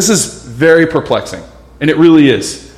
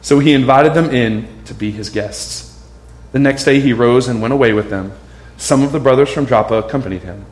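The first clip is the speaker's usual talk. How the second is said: steady, lower reading-aloud voice for the passage; scripture reading; 4 semitones lower than usual. Acted. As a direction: read the verses out to the congregation.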